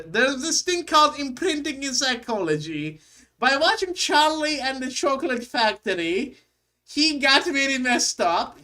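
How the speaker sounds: mock-analytical German accent, high and punchy. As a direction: explain it in a fake German voice. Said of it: "let me put in my German voice".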